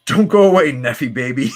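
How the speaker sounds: put-on high, loud sing-song taunt of "Neffy, baby"; cocky hero jeering at the villain; mocking taunt. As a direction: tease the villain like a bully. Thunderbird taunts Nefaria.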